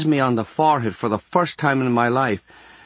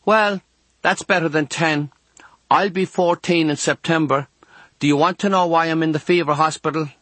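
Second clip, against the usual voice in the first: put-on high girlish chatter; girl's voice; 7 semitones higher than usual. A narrator's reading. Girl to younger boy next door, girlish voice. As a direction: talk as a girl, pitched up. Girl chats through wall.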